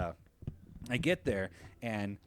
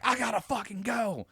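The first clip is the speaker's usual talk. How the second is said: frantic quote; 11 semitones higher, 3 dB louder than usual. Retold or imitated. Retelling his hurried exit.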